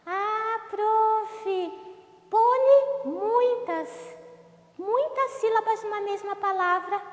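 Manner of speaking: very high put-on pupil voice; Fava. Pupil Fava tells the teacher it's understood, high put-on voice. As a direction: pipe up in a high little character voice. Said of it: "Conseguiu entender, Fava?".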